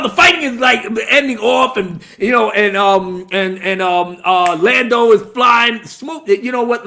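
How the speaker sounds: ordinary talk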